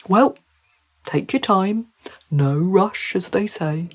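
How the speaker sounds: put-on fish character voice; obliging fish in no rush; easygoing talking trout. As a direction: let her off the hook as a polite trout. Trout tells Mary not to hurry.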